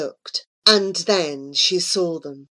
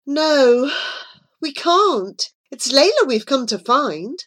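higher, insistent objection; urgent refusal; 6 semitones higher, 3 dB louder than usual. A narrator's reading. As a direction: protest with feeling, pitched up. Abu refuses, urgent in story.

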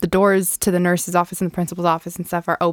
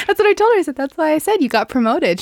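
excited; 9 semitones higher than usual. Real, not acted. Excited reply, pitch jumps.

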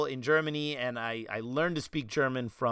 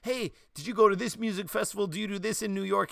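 eager people asking; 5 semitones higher, faster than usual. Retold or imitated. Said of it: "people are like".

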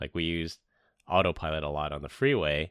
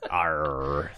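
pirate growl. Pretend. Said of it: "eye patch on like me".

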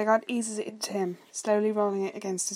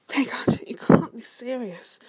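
higher, slow, half-whispered objection; weak protest; 4 semitones higher, 5 dB louder, slower than usual. A narrator's reading. Younger man to his brother in disbelief, weakly protesting. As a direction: protest weakly, drawing it out high. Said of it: "Bord protested weakly".

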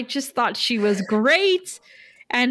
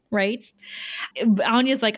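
ordinary talk

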